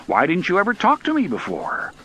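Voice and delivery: loud, high-pitched puzzlement; amazed questioning. A narrator's reading. Engineer asking why his train never talked, amazed. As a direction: press the question in astonishment.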